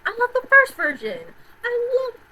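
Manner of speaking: high, loud burst of love; gushing. Real, not acted. Gushing over the first film.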